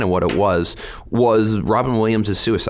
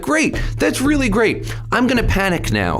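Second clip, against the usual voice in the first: sarcastic panic. Retold or imitated. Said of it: "It's like".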